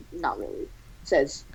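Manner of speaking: plain reading voice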